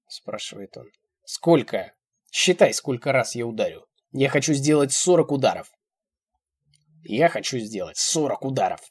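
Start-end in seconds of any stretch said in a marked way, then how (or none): 1.2-3.9 loud, forceful order; stern demand. A narrator's reading. Old man demands she count, stern. 4.1-5.7 loud, forceful announcement; loud threat. A narrator's reading. Old man announces punishment, loud threat. 7.0-8.8 deliberate repeat. A narrator's reading. Old man repeats his threat, deliberate.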